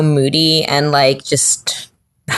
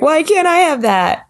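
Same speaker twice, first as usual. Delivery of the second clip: envious whine; 11 semitones higher than usual. Retold or imitated. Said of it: "It makes you feel like".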